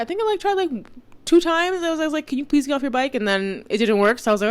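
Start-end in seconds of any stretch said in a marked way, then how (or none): 0.0-3.1 exasperated pleading. Retold or imitated. High pleading retell.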